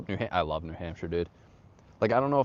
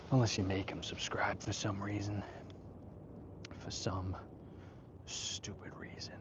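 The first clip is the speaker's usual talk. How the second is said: whispered, fading softer toward stupid reason; muttered aside; 9 dB quieter than usual. Real, not acted. Streamer complaining nobody subscribes, whispering to himself. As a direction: grumble it under your breath.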